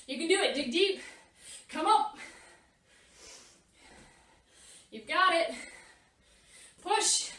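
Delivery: loud, pushing encouragement; yelling coach. Acted. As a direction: yell them through the last reps. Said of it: "I'm yelling at you to keep going".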